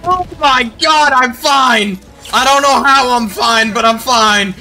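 shocked relief. Real, not acted. Surviving a blast, shocked.

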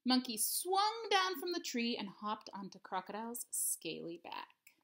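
ordinary delivery

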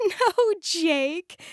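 amused laugh as she corrects him. Acted. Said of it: "laughed Fizz".

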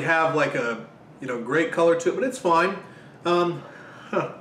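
speaking between bites of pizza; chewing while talking. Real, not acted. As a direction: mouth partly full of food. Seen 0: holds slice at mouth, bites repeatedly while speaking.

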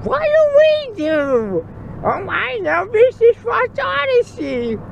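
high squeaky panic voice; panicked little sisters. Retold or imitated. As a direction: squeak like scared little kids. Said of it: "Sadie and Sage would be".

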